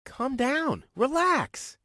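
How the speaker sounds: high-pitched, anxious calming down; nervous pleading. Acted. Pleading with an angry woman.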